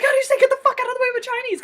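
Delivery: high, fast, loud quote; acted movie line. Fan re-enacting a funny movie line, high and hurried.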